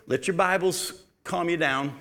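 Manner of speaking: normal talking voice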